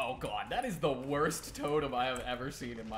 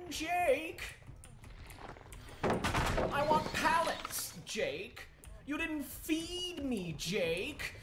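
much higher, sing-song taunting of the survivor; teasing high voice; much slower than usual. Pretend. Killer streamer taunting survivor Jake, high sing-song teasing. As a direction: coo at him like a pet you're hunting. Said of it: "All right, I'll stop".

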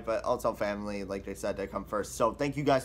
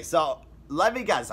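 ordinary talk

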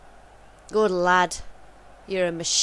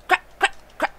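panicked curse; 10 semitones higher, 3 dB louder, faster than usual. Real, not acted. Missed chance panic.

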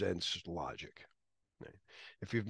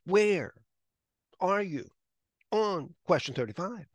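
higher, halting, overacted Kirk with long pauses; hammy Kirk; 8 semitones higher, 4 dB louder, slower than usual. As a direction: ham it up like a terrible actor, word by word. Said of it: "he's a terrible actor".

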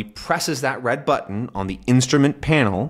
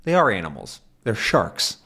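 ordinary delivery

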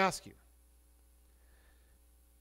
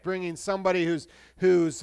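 ordinary talk

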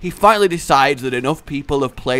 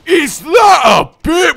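mock Fat Bastard shout; 13 semitones higher, 8 dB louder, slower than usual. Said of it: "go full fat bastard".

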